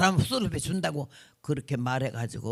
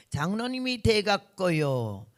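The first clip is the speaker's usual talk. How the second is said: ordinary delivery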